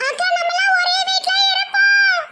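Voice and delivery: high, slow sing-song call; new bride voice. Acted. New bride calling out to the first wife, high and drawn out. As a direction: stretch it out high and sweet.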